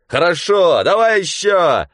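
high, loud yell; gleeful yell. Acted. Mitka yells at the waves while swimming. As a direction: roar at the wave. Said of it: "опять орал".

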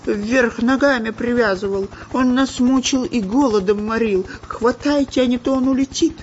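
very high, agitated complaint; frantic shouting. A narrator's reading. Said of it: "кричал шпион Дырка".

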